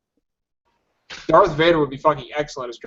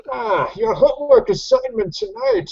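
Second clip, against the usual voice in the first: much higher, slow, pompous lecturing voice; Bane as lit professor; 8 semitones higher than usual. Retold or imitated. Bane assigning homework to students, as a pompous professor. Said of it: "sound like my college English lit professor".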